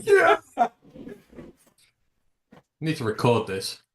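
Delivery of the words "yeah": a very high, loud squeal of yeah; squeaky yeah. Pretend. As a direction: squeal it while fleeing into the lift. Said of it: "just going".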